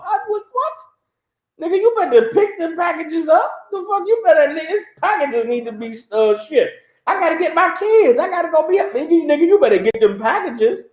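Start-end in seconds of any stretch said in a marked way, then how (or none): 1.6-6.7 high, pushy mock supervisor; demanding boss. Amazon boss demands packages, high mock bossy voice. 7.0-8.9 high, whiny mock worker voice; pleading worker. Worker begs boss to leave, high whiny mock voice.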